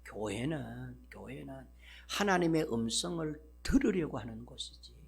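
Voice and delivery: normal talking voice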